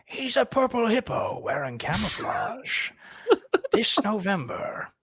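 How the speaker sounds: high, slow, hammy trailer announcer. Pretend. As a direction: sell it like a blockbuster preview. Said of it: "Narrate them in the trailer voice".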